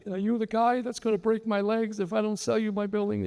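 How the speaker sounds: high mock old-lady voice; a high, playful old woman's voice; joking old lady. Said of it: "it was like".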